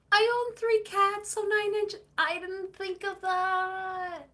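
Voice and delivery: excited. Real, not acted. Excited answer to chat question.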